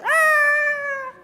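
high, loud hammed-up shriek; mock panic scream. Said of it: "our God never goes".

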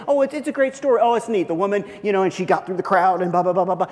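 dismissive mock. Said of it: "we don't know".

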